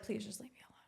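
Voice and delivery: breathy whispered plea for space; retold whisper asking for space. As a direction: hush it out, pleading.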